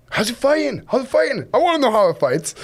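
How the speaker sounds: high, eager and quick, keen to know; eager excitement. Retold or imitated. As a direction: ask it like a kid who can't wait. Eager question to client.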